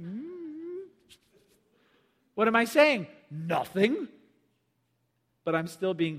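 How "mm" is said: high, soft closed-mouth hum; guilty mouth-full hum. Acted. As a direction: hum innocently through a mouthful of cookies. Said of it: "I'm like".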